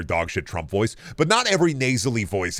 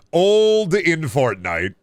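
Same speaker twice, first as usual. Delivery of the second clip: drawn-out mock hype; 6 semitones higher, 4 dB louder, much slower than usual.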